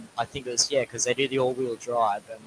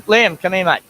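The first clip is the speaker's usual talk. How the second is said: louder call across the room; 9 semitones higher, 14 dB louder than usual. Real, not acted. Father calling his son Liam over to the camera, loud call.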